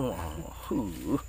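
ordinary talk

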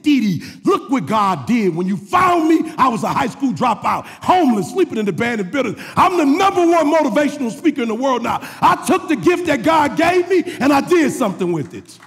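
loud, high, impassioned testimony; full-force preaching. Real, not acted. Preacher recalling what he told Didi, impassioned testimony. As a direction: build to a shouted, triumphant declaration.

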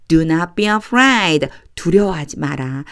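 normal talking voice

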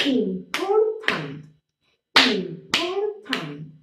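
slow important, POR loud; stress on POR. Shown as a demonstration. Teacher claps important, stress on POR. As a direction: hit the middle of important. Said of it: "Is in the middle".

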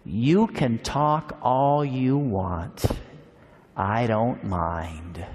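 put-on old Matthew kindly telling Anne to talk all she wants; gentle old man.

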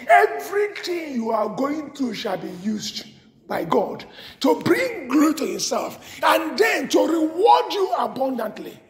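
normal talking voice

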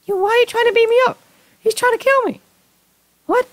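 very high, fast, loud squeal; panicked shriek. Real, not acted. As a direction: shriek in frantic protest at an attacker. Pikachu attacks the player.

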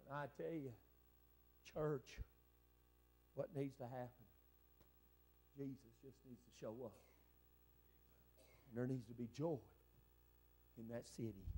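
low, very soft, with long pauses; quiet sermon appeal, phrase by phrase; hushed preaching appeal. Real, not acted. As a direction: drop right down and plead softly with the church. Hushed appeal after shouted climax.

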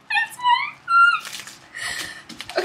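real squeal of pure delight; high-pitched squeal. Real, not acted. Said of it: "Sorry for the high-pitched squealing".

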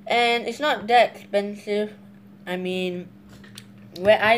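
speaking between bites; talking while chewing. Real, not acted. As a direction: mouth partly full of noodles. Seen 0: Slurped noodles just before; cheeks and lips show chewing between words.

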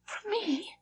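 breathless, soft and stunned; panted. A narrator's reading. Governess breathlessly asking the wailing child, panted. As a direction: gasp the question out. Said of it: "I panted".

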